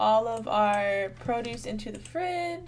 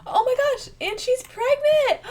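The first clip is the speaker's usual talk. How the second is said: high, loud, thrilled outburst; overjoyed squeal at the news; excited squeal; 13 semitones higher, 10 dB louder than usual. Real, not acted. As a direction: shriek it with pure delight. Sim is pregnant, thrilled.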